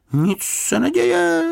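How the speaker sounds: very high, slow, carefree singsong; cheerful. A narrator's reading. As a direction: brush it off merrily, voice right up high. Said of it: "řekl Paddington zvesela". (vesele, vysokým hlasem)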